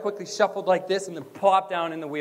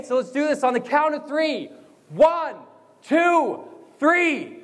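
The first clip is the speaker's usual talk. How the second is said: high, loud count to three; shouted lead-in to a chant; rousing count-in; 7 semitones higher, 3 dB louder than usual. Real, not acted. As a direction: call out the count to a crowd.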